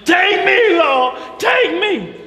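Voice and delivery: high, loud, slow wailing take me lord; hollering prayer. Retold or imitated. Preacher voicing Sarah crying out to the Lord, high loud wail. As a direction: cry out to the Lord in mock anguish. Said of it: "why is she hollering".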